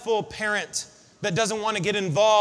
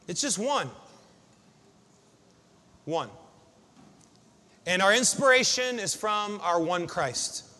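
quiet reflective preaching; 6 semitones lower, 6 dB quieter than usual. Real, not acted. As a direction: draw the room into stillness.

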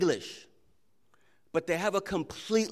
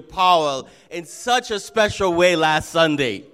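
louder, higher preacher's delivery; rally the congregation from the pulpit; preaching; 4 semitones higher, 7 dB louder than usual. Acted. Pastor thanking and rousing his congregation, preaching voice.

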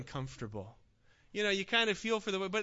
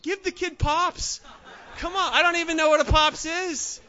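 louder, higher, laughing protest; laughing plea; 5 semitones higher, 6 dB louder than usual. Retold or imitated. Preacher jokingly pleads for the crying boy, laughing. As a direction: laugh while telling them to give it. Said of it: "I'm like".

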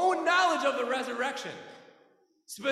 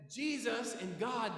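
ordinary talk, nothing marked

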